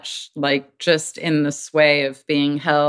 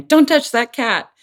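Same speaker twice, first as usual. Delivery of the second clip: higher, louder sharp warning; a mother stopping her baby; warning motherese; 8 semitones higher, 4 dB louder than usual. Shown as a demonstration. Mother warning baby off the cat, sharp and higher. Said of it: "if she's saying".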